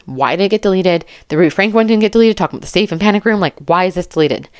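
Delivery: ordinary delivery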